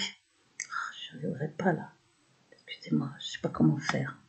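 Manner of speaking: very soft, whispered muttering to oneself; discouraged muttering. Real, not acted. Speaker struggles to go on with her video, discouraged muttering. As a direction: whisper it low, as if giving up.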